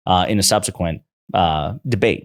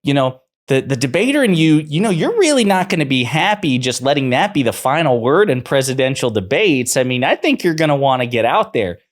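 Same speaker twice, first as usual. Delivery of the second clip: high, loud, sing-song mock of a fawning pundit; mocking upward inflection; 7 semitones higher, 4 dB louder than usual. Mocking Kellyanne Conway coaxing Trump, in a high, loud, sing-song voice. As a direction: lilt every phrase up like you're flattering him. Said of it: "The upward inflection, like".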